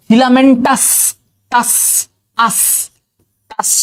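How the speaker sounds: Filamentous high, then its ending টাস repeated apart; stress on টাস. Shown as a demonstration. Teacher giving students a memory trick, stressing the ending টাস in filamentous. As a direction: break off the টাস of filamentous and repeat it between pauses. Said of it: "আস কথাটা রাখো".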